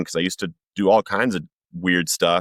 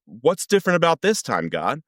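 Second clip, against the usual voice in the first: higher-pitched, exasperated challenge to God; pleading anger, voice pitched up; retold angry upset; 4 semitones higher than usual. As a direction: re-enact an upset demand for answers. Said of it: "and angry".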